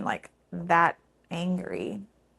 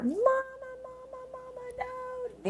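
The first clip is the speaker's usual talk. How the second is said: put-on high, slow, soft wail of a child begging for mama; retold child's pleading cry, high and drawn out; imitating children wailing; 8 dB quieter, slower than usual. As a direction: echo a small child crying for its mother, much higher. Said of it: "the children were screaming bloody murder".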